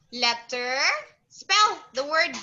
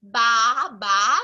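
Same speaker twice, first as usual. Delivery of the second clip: loud "baa, baa" in two beats; sheep bleat; 2 semitones lower, 6 dB louder than usual. Shown as a demonstration. Teacher repeating the sheep's sound for a child, bleating. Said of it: "So sheep says".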